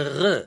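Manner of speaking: ר said as a throaty guttural gh from the back of the throat; Yemenite soft gimel. Shown as a demonstration. Lecturer showing how Yemenites say soft gimel, guttural gh. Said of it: "ג' הלא דגושה זה".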